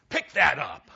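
a loud mock scolding of a kid; mock harsh order. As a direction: bark it out, anything but nice. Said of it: "you say something like this".

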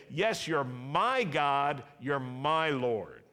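slow, loud, emphatic confession of faith; slow declaration. Shown as a demonstration. Preacher leading a call-and-response, slow and loud. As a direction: proclaim each word slowly to the congregation. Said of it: "Can you say that?".

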